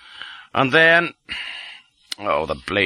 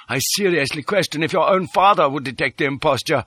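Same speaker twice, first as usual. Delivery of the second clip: higher formal character voice; Detchard, assured; 7 semitones higher than usual. A narrator's reading. Detchard praises the imposture, assured and high. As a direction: pitch it up, pronouncing a verdict.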